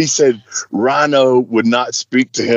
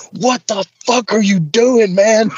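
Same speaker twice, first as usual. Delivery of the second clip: imitated exasperated demand, pitched higher; retold pissed-off; 6 semitones higher than usual. Rhino rounds on James at the car window, pissed-off. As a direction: act out an angry protest. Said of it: "And he's pissed".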